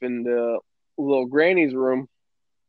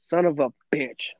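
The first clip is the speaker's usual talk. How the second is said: muttered curse; 6 dB quieter, slower than usual. Retold or imitated. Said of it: "you're like".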